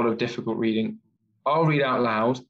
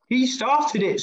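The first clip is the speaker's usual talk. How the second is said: put-on very high accusing child; storybook scream of a squabbling boy; mock scream; 11 semitones higher, slower than usual. A narrator's reading. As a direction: pitch it way up, without real shouting. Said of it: "screamed Henry".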